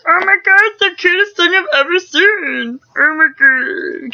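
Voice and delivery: high, loud silly squawk; excited shopper voice. Retold or imitated. Imitating herself and her friend gushing, high silly squawk. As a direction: gush like an angry little old man. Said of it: "I think my friends do that too".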